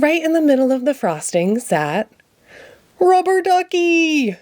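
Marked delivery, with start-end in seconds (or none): 3.0-4.4 high, slow delighted cry; overjoyed cry. Acted. Ernie spotting his lost duck on the cake, crying out high with joy. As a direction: stretch it out with joy at finding him. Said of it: "cried Ernie".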